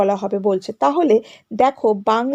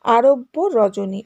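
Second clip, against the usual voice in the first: "আরব্য Rajani" slowly, with a break between the two words; two whole words, "আরব্য" then "Rajani"; split by meaning; much slower than usual. Shown as a demonstration. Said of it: "অর্থের দিকে তাকিয়ে কিভাবে". ("আরব্য রজনী" দুটি শব্দে ভেঙে ধীরে)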